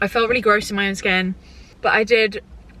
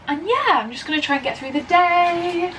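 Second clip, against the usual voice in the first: mock-bright resolve; 10 semitones higher than usual. Real, not acted. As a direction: lift it way up and stretch the ending.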